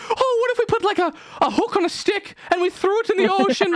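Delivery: very high, silly villager voice; hammed-up comic peasant; dim Irish villager. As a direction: pitch it way up, floating a bright idea. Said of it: "one of those dumb motherfuckers was like".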